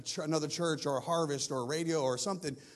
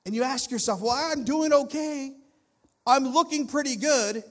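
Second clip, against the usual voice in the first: loud, high, forceful preaching; impassioned preaching; 7 semitones higher, 8 dB louder than usual. Real, not acted. Preacher confronts congregation about self-built pride, impassioned. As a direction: press each phrase home at full force.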